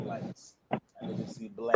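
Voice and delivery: hushed and breathy; whispering. Real, not acted. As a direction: whisper it like bedtime. Whispered about sleep.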